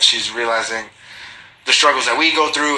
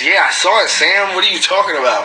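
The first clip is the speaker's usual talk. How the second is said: excited call-out; 7 semitones higher, 5 dB louder than usual. Real, not acted. Calling out Sam in chat.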